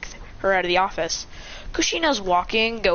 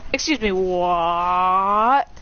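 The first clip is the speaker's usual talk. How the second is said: slow, long-stretched final word; drawn-out incredulous reaction; dumbfounded; much slower than usual. Acted. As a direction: drag out the question in disbelief. Son shocked by revelation.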